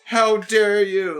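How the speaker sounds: high, overdramatic wounded wail; mocking whiny victim. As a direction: ham up a fake fatal injury.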